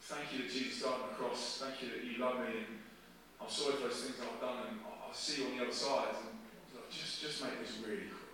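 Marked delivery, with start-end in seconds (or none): 0.0-6.1 hushed, low prayer of surrender; praying to himself. Retold or imitated. Passenger says his last prayer to himself, quietly. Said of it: "just to myself". 6.9-8.2 soft, low private plea; praying to himself. Retold or imitated. Passenger asks God for a quick end, praying quietly. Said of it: "just to myself".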